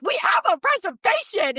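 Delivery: high-pitched retold demand; mock indignant insistence. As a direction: imitate an irate diner insisting to the host. Retold irate demand to restaurant host.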